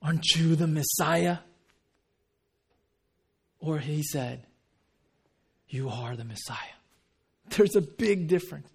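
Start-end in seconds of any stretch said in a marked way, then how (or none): 0.0-1.4 pointed question, pushed at the listener; sceptical, demanding question; demanding challenge. Shown as a demonstration. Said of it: "the attitude is completely different". 5.7-6.8 soft, low, reverent declaration; hushed, lowered voice, no question in it; humble statement. Shown as a demonstration. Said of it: "It wasn't with the question mark".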